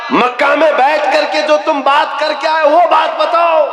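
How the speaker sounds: majestic wrath. Acted. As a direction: thunder the demand with authority. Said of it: "حضور نے جلال میں کہا".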